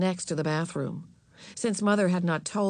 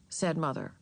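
plain reading voice

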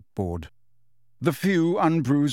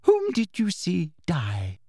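high, slow, detached question; unconcerned prim official; indifferent; 5 semitones higher, slower than usual. A narrator's reading. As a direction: ask it as mere routine. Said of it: "her tone indifferent".

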